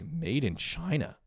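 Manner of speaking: put-on puzzled kid reading. Grown man voicing a kid reading the label on his toy, high and slow. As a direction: read the label like a baffled child. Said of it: "So you're just like".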